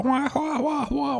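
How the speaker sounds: high yelping martial arts cries; mock kiai yells. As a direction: yelp like Bruce Lee mid-fight. Imitating Bruce Lee fight noises.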